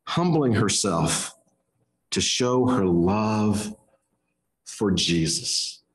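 reverent, slow and low; solemn. Acted. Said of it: "solemn climax of point".

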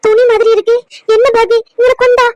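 a startled granny calling her grandson; alarmed grandmother. Acted. Grandmother to grandson about the bags, alarmed and loud.

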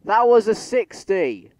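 slow, loud, high outraged yell; outraged shout. Real, not acted. Gamer shouting at the train sim, slow outraged yell. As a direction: bellow it in disbelief at the game. Train game ignored speed limit.

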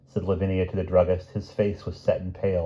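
ordinary delivery, nothing marked

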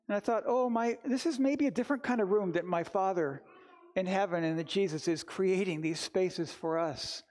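usual speaking voice

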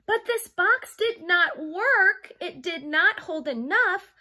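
lively picture-book read-aloud; reading to children. Acted. Teacher reading a storybook to her young class, lively. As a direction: read it like storytime for little kids.

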